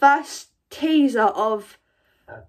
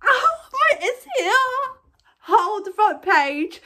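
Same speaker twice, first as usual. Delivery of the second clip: high, giddy squeal of real excitement; excited fangirling; 8 semitones higher than usual. Real, not acted. Fan spots the new teaser on the front page, high excited squealing. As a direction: gush breathlessly, voice shooting up.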